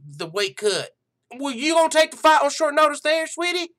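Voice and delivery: a hammed-up country gal host getting fired up, calling people honey and sweetie; hillbilly show host. Joke MMA show host ranting about Liz Carmouche, loud high hillbilly voice. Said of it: "that was a flash into the M M A frontier".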